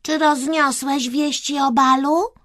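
high, loud royal question; Kreska as princess. A narrator's reading. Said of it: "zwróciła się Kreska do gołębia".

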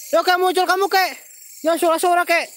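high, loud call to the ghost; shouted challenge. Real, not acted. Host dares the old ghost to show himself, shouting high. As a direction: shout it out urgently.

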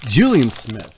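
loud, swooping delivery of a name; mock-grand name call; put-on announcer-like voice. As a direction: belt out the name in a silly voice. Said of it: "said his name in that voice".

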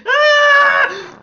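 loud high shriek; startled scream. Real, not acted. Gamer failing a jump, startled scream. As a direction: scream high at full volume. Falls in the game.

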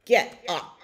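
loud, punched command; lightly voiced bellowing cow. A narrator's reading. Cow ordering the hen out, loud bellow. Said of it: "bellowed cow".

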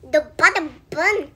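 ordinary talk

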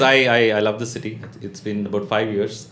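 ordinary delivery

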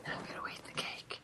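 very soft, unvoiced mock-menacing whisper; hushed, slow creepy whisper. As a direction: whisper it like a playful threat. Creepy echo of cake line.

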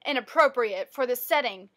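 ordinary delivery

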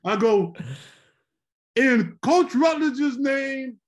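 announcing a donation at the podium, loud and slow; grand announcement. Retold or imitated. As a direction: proclaim it to the whole room. Drunk speaker announcing a donation.

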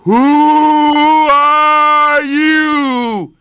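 hollow, drawn-out echo from the well; the well throwing the lion's words back, echoing. Acted. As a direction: let it ring back from far below. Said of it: "his echo came back up".

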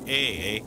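drawn-out Canadian "Eh, eh?". Shown as a demonstration. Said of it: "All you gotta learn to say is".

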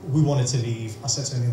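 ordinary talk, nothing marked

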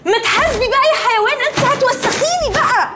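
angry shout. Acted. Roommate yells as wet laundry drips on her, angry shout. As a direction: yell at her in shock, half laughing.

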